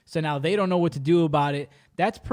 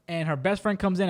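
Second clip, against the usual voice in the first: ordinary talk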